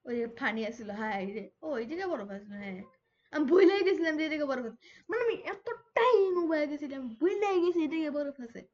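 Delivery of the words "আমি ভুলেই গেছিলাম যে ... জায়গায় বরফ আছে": loud, emphatic admission of forgetting; loud exclamation. Real, not acted. Gamer admits forgetting the ice, loud exclamation. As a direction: exclaim it loudly, hammering the point.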